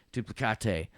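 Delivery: "Duplicate" as doo-plih-KAH-tay; mock-foreign Duplicate, ending KAH-tay; joke pronunciation. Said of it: "You thought I was gonna say duplicate".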